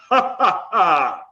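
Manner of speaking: demonstrated hearty belly laugh. Shown as a demonstration. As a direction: laugh out loud on purpose. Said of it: "with a nice hearty laugh".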